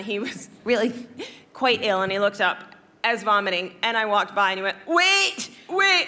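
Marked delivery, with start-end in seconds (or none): 4.9-6.0 high, urgent imitation of a fan; mock calling out. Sick boy calling to a passing celebrity, high mock cry. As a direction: call after someone passing, comically high. Vomiting fan calls out to her.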